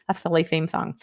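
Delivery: "silly theme song" with th for each s; "silly theme song" said with a thick lisp; playful lisp. Pretend.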